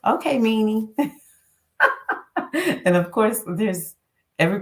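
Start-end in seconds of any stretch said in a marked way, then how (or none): 0.1-0.9 higher, teasing mock outrage; playful scolding. Telling off her inner critic, playfully. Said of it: "I'll talk like that to myself". 1.8-2.8 bright high giggle after joking; high laugh. Real, not acted. Laughing at her own self-talk, high giggle.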